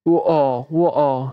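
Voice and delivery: British glottal "wo-o", t dropped; British accent. Shown as a demonstration. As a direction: drop the t in water, British style. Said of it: "British অ্যাকসেন্টে ওয়াটার এবং ও". (British উচ্চারণে ট ছাড়া "ওয়-অ")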